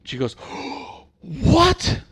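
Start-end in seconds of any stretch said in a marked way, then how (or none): 0.4-1.0 sharp indignant breath in; mock gasp. Customer shocked by the clerk's reply, mock gasp. 1.2-2.0 very high, loud indignant what; mock outraged shriek. Customer stunned by the clerk, high and loud.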